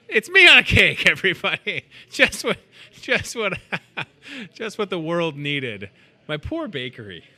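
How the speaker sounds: laughing disbelief. Real, not acted. Shown a cake with his face.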